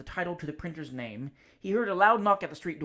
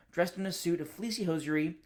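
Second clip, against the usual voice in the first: ordinary delivery, nothing marked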